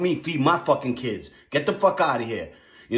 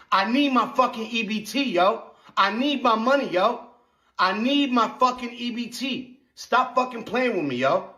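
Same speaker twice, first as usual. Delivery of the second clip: demanding rant; 6 semitones higher than usual. Real, not acted. Ranting demand for food stamps.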